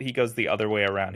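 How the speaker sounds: ordinary delivery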